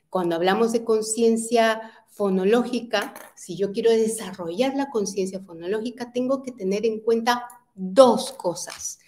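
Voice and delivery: ordinary talk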